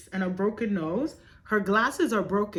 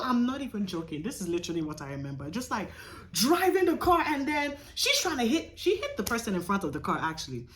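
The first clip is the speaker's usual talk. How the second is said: normal talking voice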